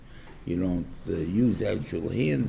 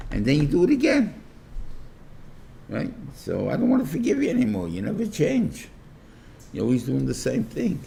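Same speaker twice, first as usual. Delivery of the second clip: louder, higher exasperated complaint; the fed-up spouse losing patience, raised exasperated voice; fed-up outburst; 8 semitones higher, 3 dB louder than usual. Retold or imitated. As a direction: let the frustration spill out loud and high.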